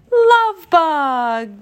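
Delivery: high, loud, drawn-out cheer; happy cry. A narrator's reading. Friends finishing Tigger's guess, happy loud cry. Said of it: "the others cried happily".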